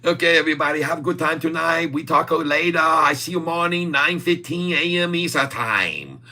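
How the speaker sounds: put-on high-pitched caricature Chinese accent; mock Chinese accent. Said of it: "We might talk a Chinese too".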